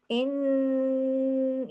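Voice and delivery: इन held long through the nose; ghunna demo. Shown as a demonstration. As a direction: hum the न of इन in the nose. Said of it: "ये गुन्ना है". (इन में लंबा गुन्ना)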